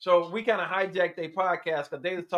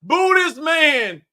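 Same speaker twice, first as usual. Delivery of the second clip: slow, high, drawn-out heckle; loud stretched-out booing; jeering shout; 7 semitones higher, 6 dB louder, much slower than usual. Real, not acted. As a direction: shout him down like a hostile crowd. Jeering the friend like a crowd.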